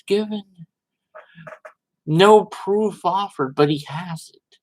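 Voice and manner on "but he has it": usual speaking voice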